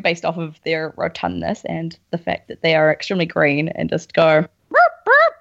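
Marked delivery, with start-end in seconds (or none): 4.7-5.3 very high, loud burp burp bird call; kakapo noise. Pretend. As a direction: squawk it like a round green bird. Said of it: "That's the sound".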